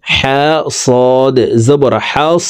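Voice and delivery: slow spell-out, "হাস" ending on a held s without bounce; no push. Shown as a demonstration. Teacher demonstrating a letter outside qalqalah, unpushed s. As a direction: let the s of "হাস" run on smoothly. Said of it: "তখন ধাক্কাবিহীন পড়তে হবে".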